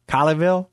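Colleyville said with a rising, asking tune; questioning try. Shown as a demonstration. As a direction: offer Colleyville as a question to the caller. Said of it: "does that sound right?".

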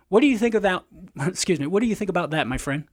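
ordinary delivery

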